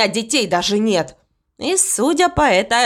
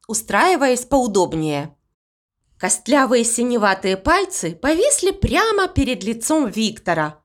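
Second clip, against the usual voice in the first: usual speaking voice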